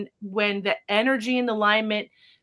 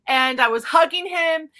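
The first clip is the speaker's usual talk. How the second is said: ordinary talk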